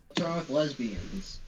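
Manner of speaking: very quiet, muffled question; muffled aside. Real, not acted. Player interjecting in the background about lesbians, muffled. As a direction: say it far under the voice, muffled. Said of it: "was that a muffled".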